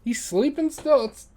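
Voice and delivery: high, fast disbelief; surprised. Real, not acted. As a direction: pitch up in surprise. Surprised friend still asleep.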